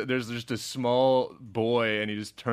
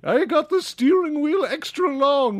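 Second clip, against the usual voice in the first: high, loud and pompous; fancy snob voice; 13 semitones higher, 5 dB louder than usual. Pretend. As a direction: boast it like a rich snob. Voicing the fancy writer.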